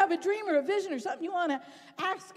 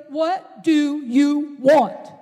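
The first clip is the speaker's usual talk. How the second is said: slow, loud question; 11 dB louder, much slower than usual. Acted. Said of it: "Jesus asks him".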